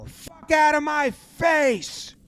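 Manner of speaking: high, drawn-out mock fury; mock angry yell. Coach in a scuffle, high drawn-out angry yell. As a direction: yell him away, stretching each word.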